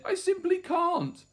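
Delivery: high little protesting voice; baby dinosaur. A narrator's reading. Third baby refusing to eat, high small voice. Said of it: "And he said".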